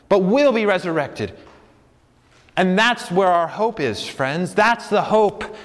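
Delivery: high-pitched, fervent proclamation; impassioned preaching. Real, not acted. Preacher declaring the hope of resurrection to friends, impassioned. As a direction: preach it with rising, urgent conviction.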